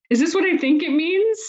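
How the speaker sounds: high, rising, breathless question; husband can't believe his eyes; excited disbelief. Retold or imitated. Husband sees positive pregnancy test.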